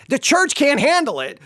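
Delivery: ordinary delivery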